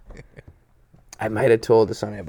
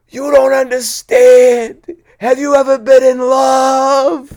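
high, loud, slow lovesick wail; hammed-up anguished cry, every word dragged; tearful yelling; 12 semitones higher, 9 dB louder, slower than usual. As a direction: wail it out like a heartbroken man. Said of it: "damn near in tears".

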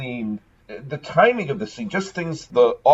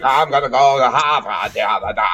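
lightly hammed-up Boston Kennedy voice; mild mock Boston accent. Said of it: "none of us sound like Kennedys".

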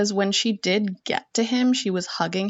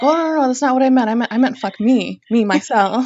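flustered backpedal; 5 semitones higher, 8 dB louder, faster than usual. Retold or imitated. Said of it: "And he was like".

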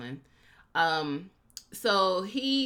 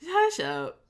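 playful hush; 11 semitones higher, 4 dB louder than usual. Real, not acted. Shushing her cohost.

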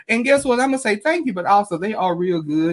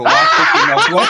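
cracking up into high, loud laughter; real laughing; 7 dB louder than usual. Real, not acted. Reading a listener's comment on greens, bursting out laughing. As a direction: read it while losing it laughing.

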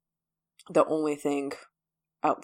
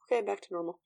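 soft, slightly higher mock calm; back to normal; 4 semitones higher, 7 dB quieter, faster than usual. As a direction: drop straight into a light, soft all-clear. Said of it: "as soon as it's done".

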